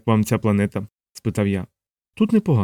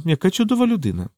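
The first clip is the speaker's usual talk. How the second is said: higher, musing remark; thoughtful; 8 semitones higher than usual. A narrator's reading. Savitri comments on the administrator, thoughtfully. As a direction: muse aloud, pitched well up. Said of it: "задумливо мовила Савітрі".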